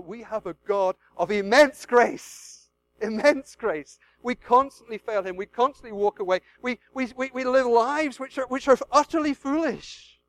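loud, building sermon cry; impassioned preaching. Real, not acted. Preacher to congregation, loud impassioned delivery. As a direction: preach it out with full force.

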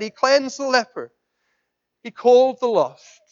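loud, high, ringing proclamation; full-force preaching. Real, not acted. Preacher proclaiming Jesus' words to the congregation, loud and ringing. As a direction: proclaim it to the back of the church.

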